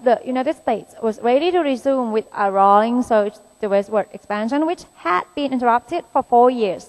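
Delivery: slow, careful reading of an exam passage; slow deliberate English reading; reading aloud. Shown as a demonstration. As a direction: read the test sentence out word by word. Reads exam sentence aloud.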